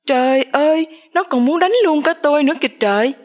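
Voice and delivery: a stepmother wailing for everyone to hear; loud cry. A narrator's reading. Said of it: "Bà Hà la to". (la to, giọng cao, nói nhanh)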